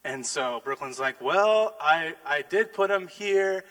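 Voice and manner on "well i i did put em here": put-on child explaining. Child explaining where gloves were.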